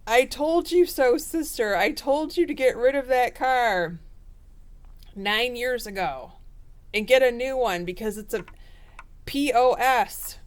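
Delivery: gloating I-told-you-so, playfully hammed up. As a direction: Scorpio lecturing the sibling, mock scolding tone. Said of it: "you're gonna say".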